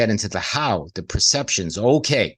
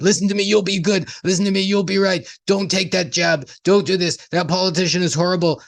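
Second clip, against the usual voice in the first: mock nagging parent; 8 semitones higher than usual. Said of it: "It's like".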